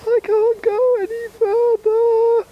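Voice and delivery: shrill high weary voice, drawn out; very high exhausted whine. Acted. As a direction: wail that you can't go on. Exhausted figure begs again.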